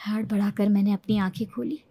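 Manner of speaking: ordinary delivery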